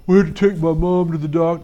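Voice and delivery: a hint of a kid's mumbly excuse voice; lightly voiced boy excuse; Barry's voice. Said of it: "that's exactly how he sounds".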